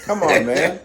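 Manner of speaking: fed-up reply, played up for the story; exasperated retort. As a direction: protest at a suspected prank caller. Acted annoyance at suspected prank call.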